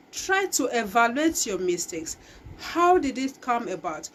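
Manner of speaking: normal talking voice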